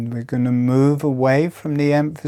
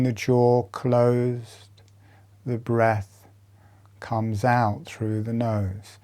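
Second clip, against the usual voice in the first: soothing body-awareness cues; guided meditation. Acted. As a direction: talk them through each breath, unhurried. Leads a jaw breathing meditation.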